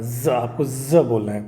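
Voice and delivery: ज said slowly as a voiced z; ज voiced, taken slowly; slow z demo. Shown as a demonstration. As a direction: drag out ज with a clear z buzz. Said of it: "इसका sound क्या आ रहा है?".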